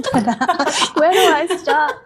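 laughing it off, voice jumping high. Real, not acted. Guest laughing at the host's long question, high and giggly. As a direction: giggle through the words, a little overwhelmed.

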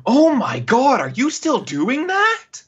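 high, loud disbelief, stretched at the end; mock exasperation. Host imagining someone walking in on the late recording, hammed-up exasperation. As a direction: pitch it up as an incredulous complaint.

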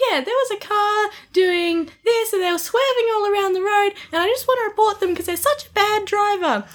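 hammed-up high complaining caller; mock complainer voice. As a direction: play a high-pitched, whining complainer. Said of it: "people are calling up and being like".

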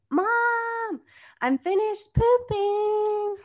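put-on high, drawn-out singsong kid call; mimicked child hollering for mom. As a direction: call out like a little kid, stretching the words. Child calling out during call.

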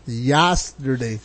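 slow, stretched "yesterday" with a flat "yas"; drawn-out yesterday. Shown as a demonstration. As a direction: drag out "yesterday" as "yasderday". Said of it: "Y A S S D E R D A".

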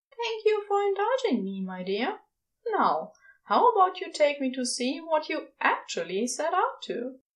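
tender and a little lifted; Aziraphale. A narrator's reading.

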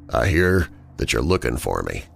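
low, silky, unhurried menace; smooth as cream. Acted. Villain calling James on the hotel line, low and silky. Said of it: "smooth as cream voice".

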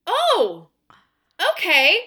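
high and loud, half-laughing disbelief; exasperated relief. Retold or imitated. Teacher telling herself off after the kid turned up fine, loud and exasperated. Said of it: "And I was like".

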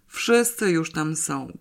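ordinary delivery, nothing marked

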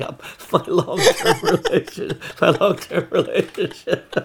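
cracking up mid-sentence, high and slow; laughing. Real, not acted. Comedian telling his breakup story, laughing helplessly. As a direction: struggle to get it out through laughter.

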